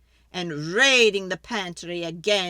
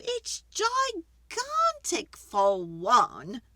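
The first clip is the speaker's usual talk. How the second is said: put-on fretful old lady; 9 semitones higher than usual. Old lady begging the wise man for help, high and pleading.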